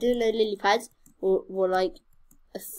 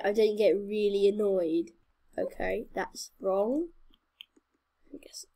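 normal talking voice

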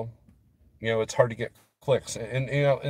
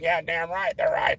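put-on, high-pitched riled-up viewer; mock angry agreement; 5 semitones higher than usual. Right-wing viewer agreeing with the news, mock-angry. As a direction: imitate a fired-up partisan egging the pundits on. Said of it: "meant to upset you and anger you".

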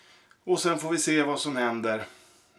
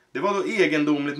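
ordinary delivery

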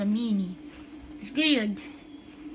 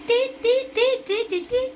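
rhythmic high staccato yelps; high "deet" chant; 9 semitones higher than usual. Pretend. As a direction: beep out a wordless chant. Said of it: "I'm just yelling".

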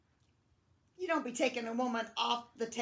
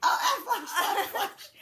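loud, higher mock vision; mock dramatic; 5 semitones higher, 6 dB louder, slower than usual. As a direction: act out a flash of visions. Acting memory flashes.

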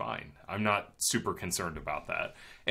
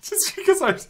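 high, loud words through real laughter; giggling so hard the words squeak out; laughing hard; 9 dB louder than usual. Real, not acted. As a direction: talk while cracking up. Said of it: "what are you laughing about?".

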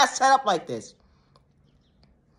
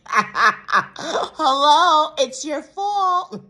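laughing; 4 semitones higher, much slower than usual. Acted. Mocking scold.

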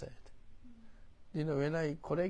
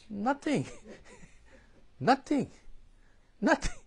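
laughing nothing; 10 semitones higher, 4 dB louder than usual. Real, not acted. Mocking the idle Israelites.

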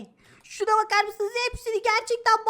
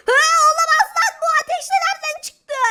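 high, fast alarmed yell; panicked shout; 5 semitones higher, 6 dB louder, faster than usual. Acted. Fire breaks out, panicking.

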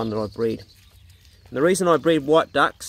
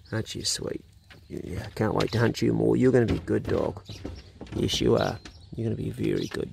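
ordinary talk, nothing marked

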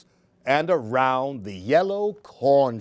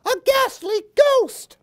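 much higher, louder squeal; frightened cry; 4 dB louder than usual. Acted. Baby Chick crying out at the ghost. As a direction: shriek it like a terrified chick. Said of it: "cried Baby Chick".

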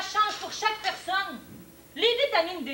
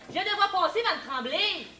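ordinary talk, nothing marked

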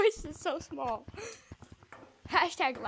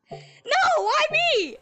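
high shout; 12 semitones higher, 6 dB louder, much slower than usual. Real, not acted. Shouted with effect.

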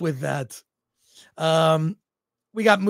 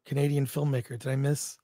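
ordinary talk

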